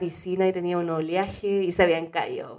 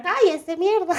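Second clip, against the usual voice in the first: imitated neighbour's outburst; 8 semitones higher, slower than usual. Neighbour telling screaming girls to shut up, mock angry yell. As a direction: snap it like a fed-up neighbour, pitch raised. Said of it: "gritando afuera y una vecina".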